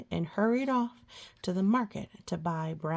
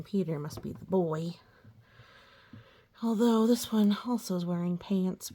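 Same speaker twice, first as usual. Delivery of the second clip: plain reading voice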